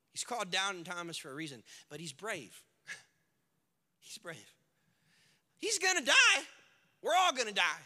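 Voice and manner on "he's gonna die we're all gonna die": loud, high alarm; hammed-up doom from the disciples; mock panic. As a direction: blurt it like sudden panic.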